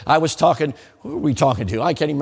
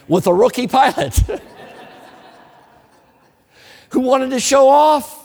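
rising alarm; 6 semitones higher than usual. Real, not acted. Reliving the terror in the glider.

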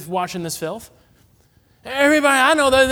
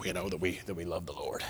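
hushed secret; 12 semitones lower, 14 dB quieter than usual. Pretend. Secret Christians whispering faith.

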